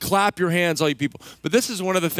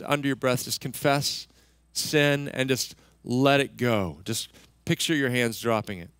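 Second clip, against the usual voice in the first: guided prayer; 5 semitones lower than usual. Acted. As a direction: ease them gently into letting go. Leads congregation in breathing prayer.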